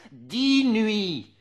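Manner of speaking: high, loud, held out; ringing exclamation. Acted. Reciter to listeners, sudden loud high outcry. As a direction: fling it out like a cry.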